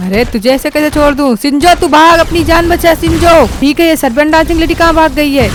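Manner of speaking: loud, fast, pumped-up yelling at enemies; battle shout. Acted. Franklin storms in and yells at villains, loud battle shout. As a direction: roar it mid-gunfight.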